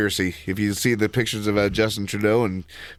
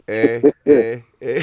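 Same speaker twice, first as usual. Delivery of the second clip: teasing Canadian "Eh, eh" louder; mock Canadian eh; 2 semitones higher, 9 dB louder, slower than usual. Buddy teasing the host's Canadian joke, mock Canadian eh. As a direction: echo the Canadian eh, playful. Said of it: "Under my umbrella".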